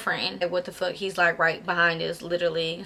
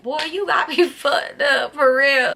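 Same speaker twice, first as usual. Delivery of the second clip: slow, higher-pitched mock outrage; imagined indignant retort; 5 semitones higher, 3 dB louder, slower than usual. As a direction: play an affronted dude, drawn out and pitched up. Imagines another dude's indignant reply.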